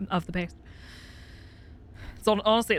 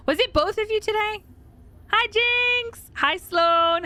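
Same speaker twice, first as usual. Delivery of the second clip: excited greeting; 11 semitones higher, slower than usual. Real, not acted. Greeting arriving viewers.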